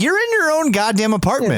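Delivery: high, exasperated rant; worked-up, high-pitched outrage at a neighbour; imagined anger. Pretend. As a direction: get indignant, pitch jumping right up. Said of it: "I would be so fucking angry".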